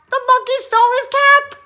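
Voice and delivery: high, loud outburst; loud, high storyteller's astonishment; shocked discovery. A narrator's reading. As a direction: exclaim it in mock outrage. Outrage at monkeys stealing caps.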